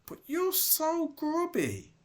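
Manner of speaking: high astonished voice; shocked. Acted. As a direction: squeal in shock. Kingsley shocked.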